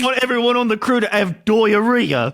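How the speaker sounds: high, loud hammed-up British accent; mock British producer. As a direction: send up a British host at full volume. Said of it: "the producer was British".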